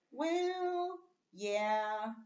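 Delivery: high drawn-out "Well, yeah"; a high, reluctant "Well, yeah"; exclamation. Shown as a demonstration. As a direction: concede it with a rising lilt. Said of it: "a way of just expressing yourself".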